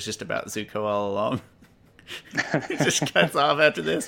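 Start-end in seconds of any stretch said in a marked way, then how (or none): 2.4-4.1 excited joke. Real, not acted. Joking about abrupt show ending.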